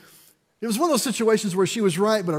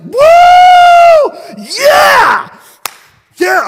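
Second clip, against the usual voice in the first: huge, high cheering yell; thrilled shout after the call; victory shout; 18 dB louder, much slower than usual. Retold or imitated. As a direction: whoop like you just won. Celebrating after the phone call.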